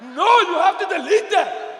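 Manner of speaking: loud, high, stretched-out protest; exasperated outburst. Acted. Son horrified by mother's comment, exasperated holler. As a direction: holler it in slow comic horror. Comic outrage at mom's comment.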